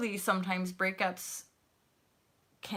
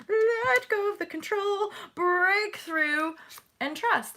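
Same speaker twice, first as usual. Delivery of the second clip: excited urging; 12 semitones higher, 5 dB louder, slower than usual. Real, not acted. Urging viewers, tarot reading.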